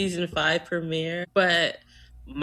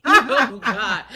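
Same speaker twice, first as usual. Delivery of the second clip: laughing out loud, higher; 5 semitones higher, 8 dB louder than usual. Real, not acted.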